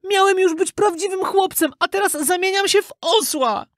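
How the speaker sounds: very high, woeful puppet voice; Pinokio worrying. A narrator's reading. Puppet lamenting his lost chance to be human, worried. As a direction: lament it in a piping voice. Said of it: "martwił się Pinokio".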